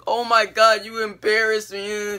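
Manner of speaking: high, drawn-out imitated complaint; mock upset. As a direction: whine it as an upset little sister. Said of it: "she's pretty upset after that".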